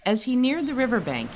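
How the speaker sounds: ordinary delivery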